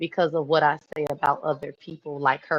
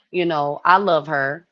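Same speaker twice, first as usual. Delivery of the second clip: normal talking voice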